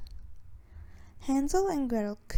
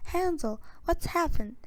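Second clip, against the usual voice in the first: calling out; 6 semitones higher than usual. A narrator's reading. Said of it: "called Gretel".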